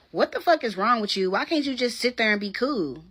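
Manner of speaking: loud, sharp mock yelling; angry yell. Boyfriend snapping at her in the car, loud yell. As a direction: bark it at her like he did.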